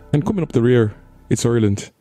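put-on sorry stab at an Irish accent; Irish accent attempt. Vlogger introducing Ireland's entry, badly faked Irish accent. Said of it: "really sorry attempt at an Irish accent".

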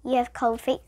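usual speaking voice

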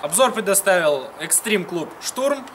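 usual speaking voice

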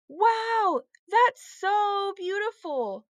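high, drawn-out wow of wonder; awed admiration. A narrator's reading. Lucy seeing the charm bracelet, awed admiration. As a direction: gush slowly in high-pitched awe.

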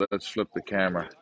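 talking while chewing gum. Real, not acted. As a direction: chew gum between the words. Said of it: "Sorry for my gum chewing".

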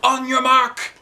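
high, loud starter's call; yelled. Acted. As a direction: bark the start of the race. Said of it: "yelled Neil".

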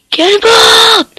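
high excited yell, very loud; cannonball yell. Real, not acted. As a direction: jump in yelling it. Jumping down into water.

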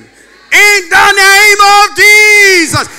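loud, high, drawn-out proclamation; preaching at full force. Acted. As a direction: shout the name to the crowd.

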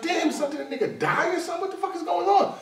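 mock surprise. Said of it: "I was like".

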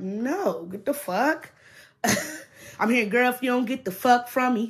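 put-on girl, get away from me; sassy brush-off. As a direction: shoo them away with attitude. Telling off the manipulator.

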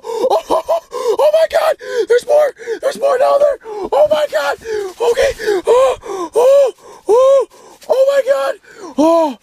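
ecstatic screaming, very high-pitched; real freaking out. Real, not acted. Angler landing a personal best crappie, freaking out. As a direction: scream it over and over, losing it with joy. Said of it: "I'm freaking out".

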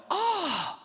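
high, squeaky startled "Oh!"; a jump of mock surprise; surprised oh. Listener hears he's a pastor.

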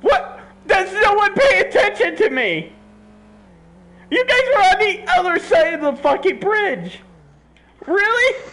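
high-pitched, indignant whine; high, aggrieved protest; exasperated complaint. Real, not acted. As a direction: scold teammates for not paying attention. Complaining that teammates ignore them.

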